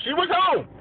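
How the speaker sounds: high-pitched. Pretend. Joking about visiting the Queen.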